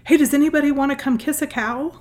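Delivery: put-on high, bright child calling out; little daughter's voice. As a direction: call out like an excited nine-year-old. Mother voices young daughter calling.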